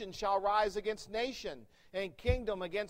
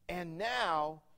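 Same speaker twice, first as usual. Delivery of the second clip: very slow scripture read; much slower than usual. Shown as a demonstration. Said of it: "now you know".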